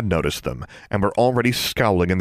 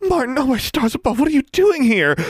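put-on very high, breathless girlish gush; excited squeal; 4 dB louder than usual. Smitten girl spotting her crush, high excited squeal. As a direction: squeal it all in one breath. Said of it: "Darla squealed in one breath".

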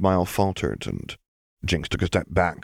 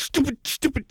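"stupid, stupid" shrieked far higher; frantic self-loathing. Acted. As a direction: spit each word at herself, unhinged. Punching herself in rage.

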